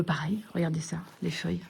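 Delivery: soft dismayed whisper. Real, not acted. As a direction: breathe it over the leaves. Showing damaged leaves.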